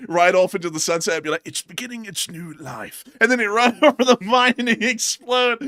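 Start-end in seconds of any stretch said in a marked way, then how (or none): 1.5-3.0 brief hint of a nature narrator; quick quote in a documentary voice; David Attenborough. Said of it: "trying to do the David Attenborough thing". 3.2-5.6 high, loud, through laughter; laughing retelling. Real, not acted. Retelling the mishap while laughing.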